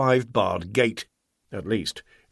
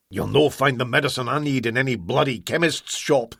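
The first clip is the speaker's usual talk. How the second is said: ordinary delivery, nothing marked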